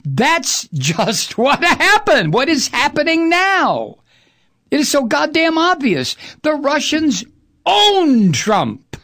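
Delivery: high-pitched, insistent rant with drawn-out key words; emphatic exasperation. Real, not acted. Host insisting to listeners it is obvious, emphatic exasperation. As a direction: drive each point home, pitch riding high.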